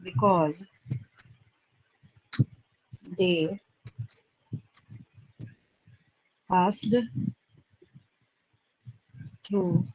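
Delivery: low, soft, one word at a time; slow dictation. Real, not acted. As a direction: talk to the pen, long pauses between words. Dictating while writing out the statement. (धीमे स्वर में, एक-एक शब्द लिखवाते हुए)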